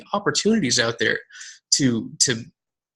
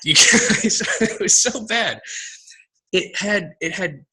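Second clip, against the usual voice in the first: laughing; 6 semitones higher, slower than usual. Real, not acted. Laughing at own bad invites.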